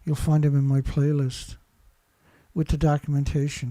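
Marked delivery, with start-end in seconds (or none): none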